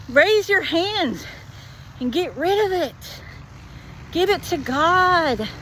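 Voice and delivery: put-on high fervent urging for her scared boyfriend; earnest pleading. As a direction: plead with him to let go of fear. Urging boyfriend, echoing singer.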